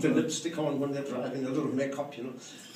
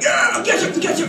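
egging on; 13 semitones higher, 8 dB louder than usual. Retold or imitated. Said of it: "The guys are like".

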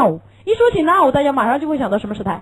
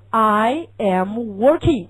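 slow repeat; 3 semitones lower, much slower than usual. Shown as a demonstration.

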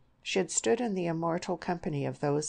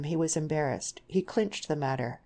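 ordinary delivery

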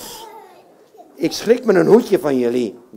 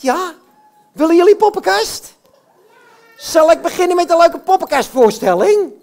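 puppet voice; 6 semitones higher, 4 dB louder than usual. Acted. Puppet Jan Klaassen voice.

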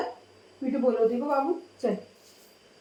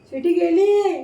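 called out; 4 semitones higher, 6 dB louder than usual. Real, not acted. Announcing to the parrot.

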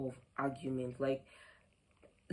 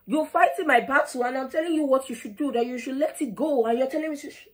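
much higher and louder, a rush of indignant protest; heated outburst; 9 semitones higher, 6 dB louder than usual. Retold or imitated. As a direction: tell the elderly man off, voice climbing with outrage. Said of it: "I was like".

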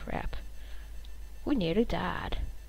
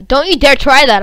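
loud, high scolding; raised voice, indignant at a rival player; heated warning; 7 semitones higher, 13 dB louder than usual. Real, not acted. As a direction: warn them off loudly. Warns opponent off the water source.

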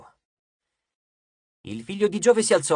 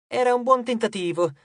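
plain reading voice

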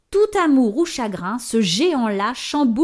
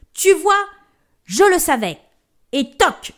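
loud, higher smug giant; dim slow booming giant; 8 semitones higher, 6 dB louder than usual. Acted. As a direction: gloat like a proud giant. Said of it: "dit le géant". (géant triomphant)

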